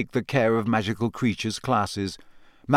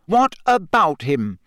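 cold, slow and higher, leaning on what; hard voice; 5 semitones higher, 4 dB louder, slower than usual. A narrator's reading. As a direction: shut him down coldly. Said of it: "said Parvati in a hard voice".

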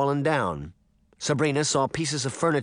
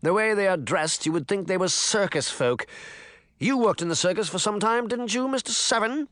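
raised, pompous official voice; pompous mayor; 9 semitones higher than usual. A narrator's reading. Mayor talking down to his assistant, pompous and raised. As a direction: lord it over the little man.

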